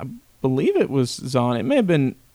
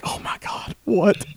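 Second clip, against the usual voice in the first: disbelief; 4 semitones higher, 3 dB quieter, slower than usual. Retold or imitated. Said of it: "and I'm like".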